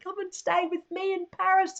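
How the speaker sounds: lightly voiced kind friend; kind invitation. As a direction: invite her sweetly to stay. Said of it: "she's very kindly said".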